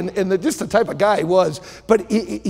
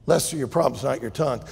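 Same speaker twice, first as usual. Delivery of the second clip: calm doctor; 5 semitones lower, 4 dB quieter, faster than usual. Acted. Doctor Law answers Lester.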